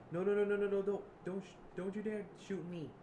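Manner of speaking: high, frantic string of pleading; loud at first, begging at gunpoint; hammed-up terrified protest; acted panic. Acted plea with gun pointed.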